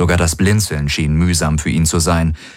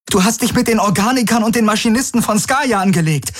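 much higher, fast insistent urging; urgent young woman; 13 semitones higher than usual. A narrator's reading. Kirai rallying Garlin against the program, high urgent. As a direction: drive him to fight back.

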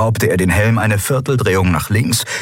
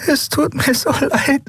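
tearful. A narrator's reading. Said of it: "weinte Endriel".

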